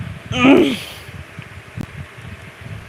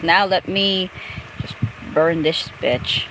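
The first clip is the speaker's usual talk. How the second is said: ordinary talk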